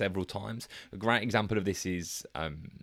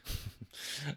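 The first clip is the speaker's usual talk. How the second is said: soft laugh; 7 semitones higher, 11 dB quieter than usual. Real, not acted. Laughs at own stalling.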